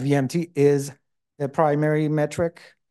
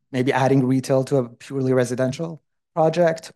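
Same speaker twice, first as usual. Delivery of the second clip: normal talking voice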